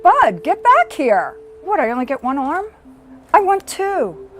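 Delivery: high, loud teasing mom voice; mock-pouty mom begging for a second arm; playful mock demand. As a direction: call him back like a mom wanting a proper hug. Mom teasing son for hug.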